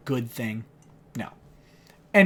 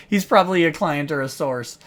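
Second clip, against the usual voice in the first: normal talking voice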